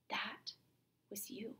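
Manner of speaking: breathy hushed whisper on was you; whispered accusation. Acted. Teacher as Roxanne realizing the truth, hushed whisper. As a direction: drop to a bare whisper, as if realizing.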